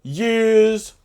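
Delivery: very high and loud, booming title card; hammed-up trailer announcer for the time jump. Movie title card parody.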